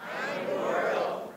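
breathy whispered declaration, almost silent. Shown as a demonstration. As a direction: whisper "I'm immortal" like a secret. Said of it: "Try it again".

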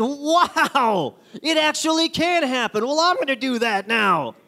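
mock wow.

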